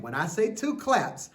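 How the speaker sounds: usual speaking voice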